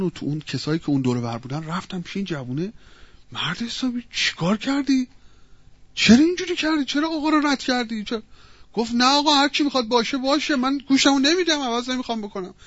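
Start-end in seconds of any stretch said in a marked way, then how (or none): 3.3-8.2 high-pitched reproachful questioning; acted scolding. Bystanders rebuking the butcher for refusing the master, scolding. 8.9-12.5 acted defiant refusal. Butcher defiantly answers the bystanders.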